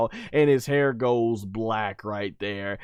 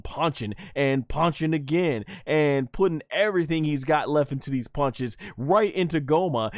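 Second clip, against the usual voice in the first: ordinary talk, nothing marked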